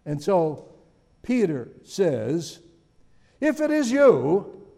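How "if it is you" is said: high, slow doubting "If" with a rising tune; doubtful. Retold or imitated. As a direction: question whether it's really him. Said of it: "that sounds like it's a little doubtful".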